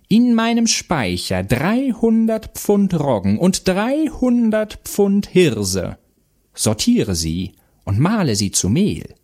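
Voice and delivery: slow, high, sing-song witch orders; Baba Yaga commanding. A narrator's reading. Baba Yaga hands out the next task, commanding. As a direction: stretch the command out high, then drop for the order. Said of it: "befahl die Baba Yaga weiter".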